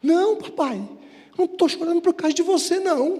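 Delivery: imitating tearful child. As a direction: play a son objecting through tears, without real sobs. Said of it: "começou a chorar". (imitando filho choroso)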